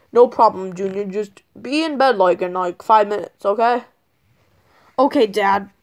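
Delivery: usual speaking voice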